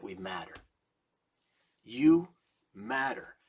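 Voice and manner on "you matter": slow, louder declaration; emphatic preaching. Real, not acted. Preacher to parents of prisoners, emphatic declaration. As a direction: proclaim it to each of them. Preacher driving the point home.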